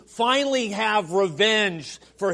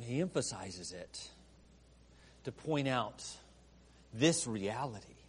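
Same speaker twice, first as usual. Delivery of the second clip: much softer and lower, fading; hushed emphasis; 9 semitones lower, 14 dB quieter than usual. Acted. Preacher drives home who roars, falling to a hush. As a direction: drop to a near hush for weight. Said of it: "hushed closing emphasis".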